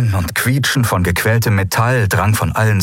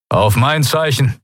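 raised-pitch order; commanding countdown; 5 semitones higher than usual. Acted. Admiral to crew before the final detonation, commanding and raised. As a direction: give the order in a higher, ringing voice.

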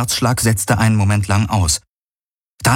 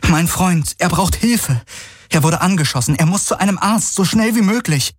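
much higher, pleading urgency; urgent heroine; 11 semitones higher than usual. A narrator's reading. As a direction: push the words out, worried. Heroine begs for help.

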